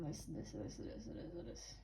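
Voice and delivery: soft unvoiced whisper, fading away. Real, not acted. Tarot reader trailing off while listing, whispered. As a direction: breathe the words out to nothing.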